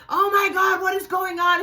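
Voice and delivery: high, shrieking imitation of mom; retold yelling. Mom hearing the fight over the phone, yelling. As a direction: holler down the phone, re-enacted. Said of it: "you could hear my mom yelling on the phone".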